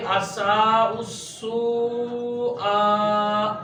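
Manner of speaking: असा उस्सू आ slowly, each long vowel stretched; correct pronunciation demo. Shown as a demonstration. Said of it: "इसका तलफ़ुज़ किस तरीके". (असा उस्सू आ को सही तलफ़ुज़ में खींचकर)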